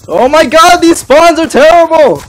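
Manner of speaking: high, loud slow complaining shout; frustrated yelling. Real, not acted. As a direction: yell out the complaint. Raging about spawns.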